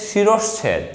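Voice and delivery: শিরশ্ছেদ with a clear middle sh; correct pronunciation. Shown as a demonstration. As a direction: say শিরশ্ছেদ as shi-rosh-chhed. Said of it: "উচ্চারণ হচ্ছে".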